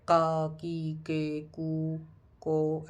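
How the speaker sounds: "का, की, के, कू, को" as Japanese ka ki ke ku ko; drawn-out syllables, "कू" slow and stretched; showing viewers the Japanese K-row. Shown as a demonstration. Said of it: "तो ऐसे sound करेगा".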